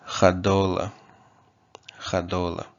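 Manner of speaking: "Chadola" with throaty ch, stress on do; Kotava pronunciation. Shown as a demonstration. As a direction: say "Chadola" as kha-DO-la. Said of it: "stress is on the previous syllable".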